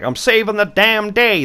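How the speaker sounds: a lightly done tough-guy line; Daryl impression. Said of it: "That's my terrible, um, Daryl impression".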